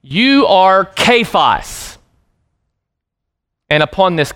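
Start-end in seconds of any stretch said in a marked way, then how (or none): 0.0-2.0 slow declaration. Shown as a demonstration. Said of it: "here's what Jesus would have said to Peter".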